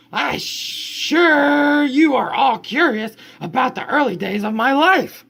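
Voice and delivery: bright, high storybook voice for the chatty dog; reading the dog's chatter to the kids, high and lively; reading to children. Acted. Parent reading the dog's words to kids, high and bright.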